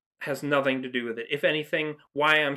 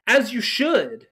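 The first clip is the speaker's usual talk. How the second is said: slow, higher, louder cheer; emphatic approval; 7 semitones higher, 5 dB louder, slower than usual. Real, not acted. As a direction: stamp your approval on it. Cheering the actor's intent.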